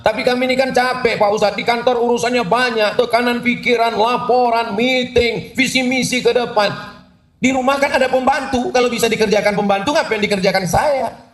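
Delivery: high, complaining excuse; a hint of a whiny working mother; whiny career wife. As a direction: whine to the preacher about being tired.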